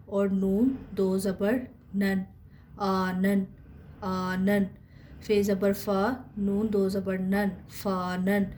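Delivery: each letter and vowel mark named slowly, then the syllable; slow spelling drill. Shown as a demonstration. Teacher spells out syllables slowly for students. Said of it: "teacher spelling out letters".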